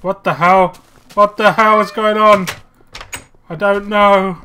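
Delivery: loud, high bewildered outburst; shocked. Real, not acted. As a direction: react in baffled alarm. Something strange happens in game.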